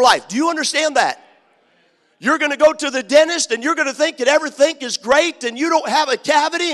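preaching. Acted. Preacher warning the congregation about heartbreak, preaching loud. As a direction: preach it hard, building to shouts.

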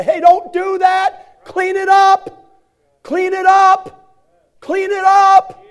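high, loud, slow drill-sergeant bark; stern shout. Retold or imitated. Mentor shouting correction at the young student. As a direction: hammer each word like an army sergeant. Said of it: "stand up and say".

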